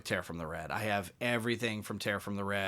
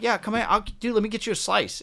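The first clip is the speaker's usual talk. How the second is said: eager invitation, much higher and faster; excited welcome; 12 semitones higher, 6 dB louder, faster than usual. Retold or imitated. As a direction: welcome the band guy in with excitement. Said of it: "And so I was like".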